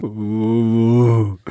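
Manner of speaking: long sustained wordless "oo"; acted tearless wail; low dreadful moan. A narrator's reading. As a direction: moan on one held note. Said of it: "тем же низким страшным звуком".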